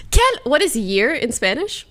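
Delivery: usual speaking voice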